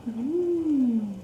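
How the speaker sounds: ordinary delivery, nothing marked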